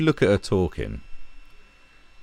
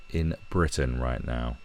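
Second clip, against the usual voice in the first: ordinary delivery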